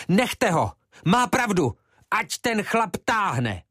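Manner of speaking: high, ringing cries over the crowd; fiery shout. Acted. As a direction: egg the crowd on. Brana yells at the crowd.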